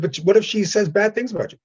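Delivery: high-pitched imitation of anxious onlookers; worried questioning. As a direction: pitch it up like a concerned bystander. Onlookers worrying in retold exchange.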